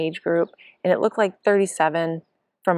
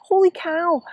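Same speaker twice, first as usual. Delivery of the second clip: very high holy cow, louder; shocked; 12 semitones higher, 4 dB louder than usual. Retold or imitated. Hearing the five oh nine from husband, shocked and high. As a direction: yelp it out in shock. Hearing her total time.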